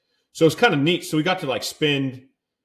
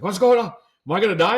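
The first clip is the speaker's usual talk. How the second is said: high, louder panicked Latin-accented mimicry; thick Latin accent; 10 semitones higher, 6 dB louder than usual. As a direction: imitate a frantic Latin woman, pitched way up. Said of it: "some thick Latin accent".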